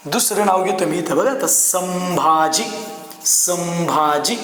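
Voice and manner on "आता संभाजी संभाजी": संभाजी said very slowly, letting the म sound before भ come through. Shown as a demonstration. Teacher slowly sounding out संभाजी to show the nasal. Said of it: "आता याच्यात कसं होतं".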